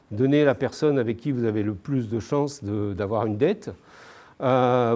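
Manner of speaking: ordinary talk, nothing marked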